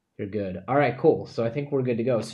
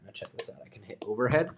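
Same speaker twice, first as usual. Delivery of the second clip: hushed whisper; 5 dB quieter, faster than usual. Real, not acted. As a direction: whisper it like a secret. Whispered while opening the box.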